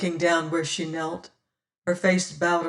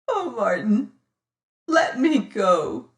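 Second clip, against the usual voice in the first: sobbing; 4 semitones higher, 4 dB louder than usual. A narrator's reading. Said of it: "she sobbed".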